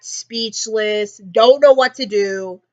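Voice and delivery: normal talking voice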